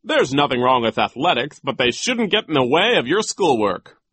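emphatic schoolteacher voice; opinionated teacher. Acted. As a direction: press the point firmly.

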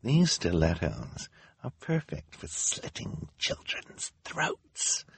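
hushed villainess sinking into a whisper; secretive, sinister, breathy at the ends; quiet menace. A narrator's reading. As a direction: threaten under your breath, savouring it. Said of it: "in a quiet voice so the audience".

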